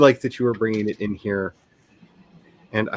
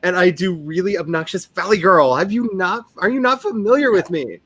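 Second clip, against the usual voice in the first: higher, hammed-up Valley Girl accent; bad Valley Girl; 6 semitones higher than usual. Mimicking a co-host's accent, a hammed-up Valley Girl. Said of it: "going to sound like a really bad Valley Girl".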